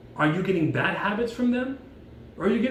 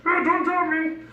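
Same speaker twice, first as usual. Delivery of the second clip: tiny squeaky voice, much higher; panicked tofu; 13 semitones higher than usual. Acted. The tofu begging the speaker not to drop it, squeaky high panic. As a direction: squeal it like a frightened little food. Tofu nearly dropped, cries out.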